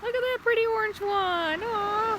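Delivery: high sweet cooing voice. Real, not acted. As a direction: coo over the pretty leaf. Cooing at pretty leaf.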